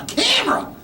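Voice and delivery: "A camera?" squeaked in disbelief; shocked squeak. Retold or imitated. Comedian squeaks back at his doctor, mock horrified.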